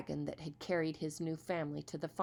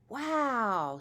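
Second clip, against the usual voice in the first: impressed wow; 6 semitones higher, 5 dB louder than usual. Acted. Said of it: "said the other boys".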